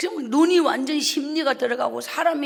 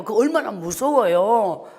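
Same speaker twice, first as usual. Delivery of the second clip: ordinary talk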